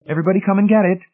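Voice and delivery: high, loud dinner summons; sharp call. A narrator's reading. Marcia summoning the household to eat, sharp and loud. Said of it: "Marcia called sharply".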